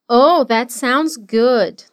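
very slow, drawn-out delivery; slow model. Shown as a demonstration. Teacher slowly modeling a reply for students. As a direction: stretch each word for learners. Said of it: "O verbo sound significa parece".

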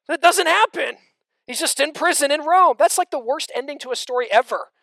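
loud, high, driving sermon delivery; fiery pulpit voice; full-force preaching. Acted. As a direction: preach it out to the whole room.

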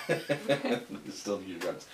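repeating it through a laugh; amused, slow echo. Real, not acted.

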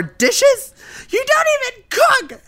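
rising to a high mock shout; mock scornful yell. Maria scolding Samantha over dishes, high mock yell. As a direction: scoff at her, then shriek. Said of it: "And then Maria's like".

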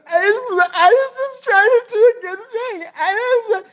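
high-pitched, drawn-out mock wail; hammed-up tearful breakdown, imitating a sobbing girl; mock crying. Teller mimicking the crying girl after the party, hammed-up wailing. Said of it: "where she's crying".

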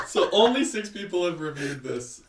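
high laugh spilling into the words; giggling through the explanation. Real, not acted. To cohost about the six reviews, laughing.

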